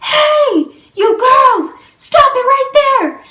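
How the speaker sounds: high, loud yell to halt them. Retold or imitated. Man catching girls doorbell ditching, loud high yell. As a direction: bark out a command to stop. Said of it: "we hear the loudest".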